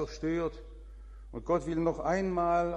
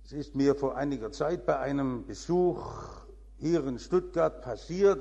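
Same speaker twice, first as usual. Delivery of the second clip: ordinary talk, nothing marked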